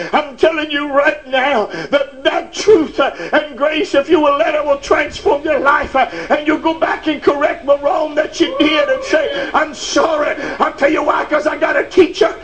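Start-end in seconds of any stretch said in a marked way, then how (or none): 4.1-12.3 fiery preaching. Acted. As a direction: drive it home to the church. Preaching at full force again.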